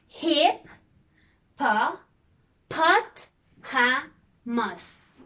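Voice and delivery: hip-pa-pot-ha-mus, each syllable apart with pauses; split into syllables. Shown as a demonstration. Teacher splitting a word for kids, syllable by syllable. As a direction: chop hippopotamus into beats. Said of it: "let's divide the word into syllables".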